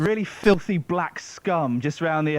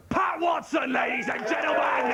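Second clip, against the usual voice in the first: big host build-up, shouted name; announcer shout; 8 semitones higher than usual. Real, not acted. Host calling the comic onstage with a shout. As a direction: call the act on like a compere. Host introducing the next act.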